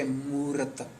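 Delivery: Murat ending on t with no i sound; common reading, i dropped. Retold or imitated. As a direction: say Murat closed at the t. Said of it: "they say, Mu".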